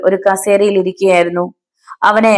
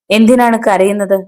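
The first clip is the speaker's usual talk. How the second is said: plain reading voice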